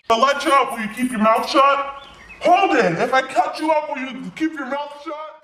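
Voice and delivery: high-pitched, heated bargaining; exasperated demand. Acted. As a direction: press him angrily for a promise. Roommate bargains while holding him down.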